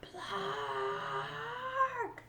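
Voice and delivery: high, long drawn squawk; long bird cry. Acted. Little Bird squawking a long cry at Turtle. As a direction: stretch the squawk out.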